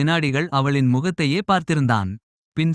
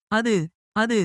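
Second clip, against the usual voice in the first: stammering; 6 semitones higher, slower than usual. A narrator's reading. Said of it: "என தடுமாறினாள் பிரியா".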